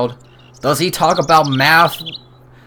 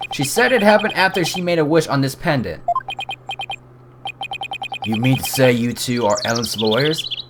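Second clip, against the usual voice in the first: usual speaking voice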